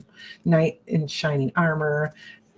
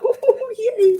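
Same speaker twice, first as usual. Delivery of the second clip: much higher mock little cheer; inward cheer; 4 dB louder than usual. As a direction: squeal a secret yay. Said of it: "inwardly, I'm like".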